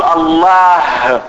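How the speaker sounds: الله with the final h clearly breathed out. Shown as a demonstration. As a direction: stretch الله slowly and let the h be heard. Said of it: "تسمع الهاء".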